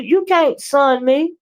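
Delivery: usual speaking voice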